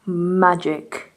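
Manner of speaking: low, understated "Magic". Pretend. Vlogger showing a little trick on camera, low magic. Repeating a word two ways.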